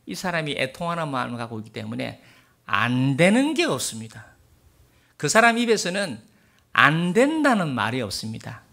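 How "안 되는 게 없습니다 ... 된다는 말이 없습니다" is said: louder, hammering each phrase; forceful preaching. Acted. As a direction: preach it out with conviction.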